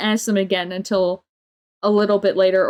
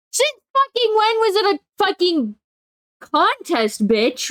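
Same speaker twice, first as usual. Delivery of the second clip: high, indignant outburst; outraged disbelief; 9 semitones higher, slower than usual. Real, not acted. Ranting about a coworker, outraged and pitched high. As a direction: demand how it became a contest.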